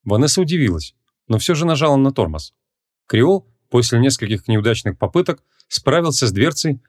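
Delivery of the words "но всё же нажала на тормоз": ordinary delivery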